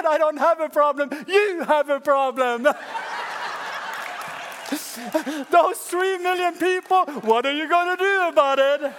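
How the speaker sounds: high, loud, laughing defiance; triumphant Moses. Acted. Moses laughing as he tells God to handle it, high and loud. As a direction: toss the problem back at God.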